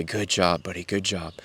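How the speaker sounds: soft, gentle praise to a little son; father comforting his son after swimming, softly; tender praise. Retold or imitated. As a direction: hush it like comforting a child. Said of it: "and I would say".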